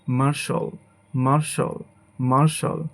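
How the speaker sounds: মার্শাল said "mar-shol"; two-syllable মার্শাল ending in "shol"; correct pronunciation. Shown as a demonstration. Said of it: "সঠিক উচ্চারণই করি".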